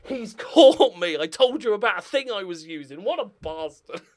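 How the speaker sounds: hammed-up caught-out cry, slowed and laughing; mock busted villain. YouTuber mocking himself as a villain caught out, hammed-up. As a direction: play the exposed crook, laughing.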